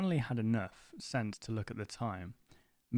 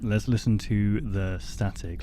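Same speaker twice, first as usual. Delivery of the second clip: mock ASMR voice; 6 dB louder than usual. Streamer obliging a chat request, in a mock ASMR voice. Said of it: "A S M R voice".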